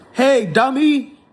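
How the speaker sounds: scornful call-out at the pundit; angry shout. Real, not acted. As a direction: yell "dummy" at him with contempt. Scolding the rich pundit.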